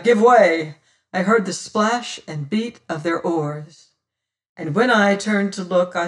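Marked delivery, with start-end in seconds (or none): 0.0-0.8 loud repeated order; crying out. A narrator's reading. Adam urges the lads at the oars, crying out. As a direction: call it out to the rowers. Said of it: "he cried".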